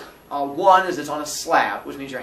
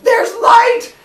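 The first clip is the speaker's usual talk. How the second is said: high loud excited cry; excited shout; 13 dB louder than usual. Retold or imitated. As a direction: kid seeing a beam of light in the dark, excited shout. Excited seeing light.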